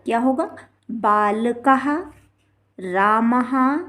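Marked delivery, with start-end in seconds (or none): 0.9-1.9 बालकः slowly, ending in "ha"; visarga as ha. Shown as a demonstration. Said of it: "हा उच्चारण इसका होगा". 2.8-3.8 रामः drawn out slowly as "raa-ma-ha"; visarga as ha. Shown as a demonstration. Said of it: "हा उच्चारण इसका होगा".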